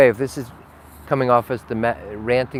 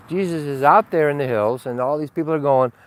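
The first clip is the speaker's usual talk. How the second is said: ordinary talk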